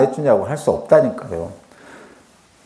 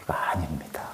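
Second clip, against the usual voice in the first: hushed whispered denial; whispering to the congregation; 12 semitones lower, 17 dB quieter than usual. Real, not acted. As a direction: breathe it, barely voiced. Denies the mocking reading.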